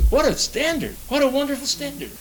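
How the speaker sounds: high, wondering exclamation; marveling. Real, not acted. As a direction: lift the voice in awe at the standard. Preacher marvels at scripture.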